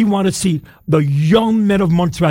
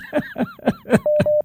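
high, soft laughter; real amused chuckling; 6 dB quieter than usual. Real, not acted. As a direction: laugh quietly at the caller, trailing on. Host amused by the caller.

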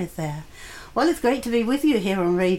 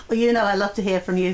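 ordinary talk, nothing marked

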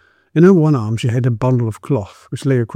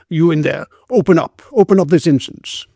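raised-voice demand through a closed door; repeated shouted order; 5 semitones higher than usual. A narrator's reading. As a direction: call out higher, insisting on entry. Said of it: "he knocked again with his fist and repeated".

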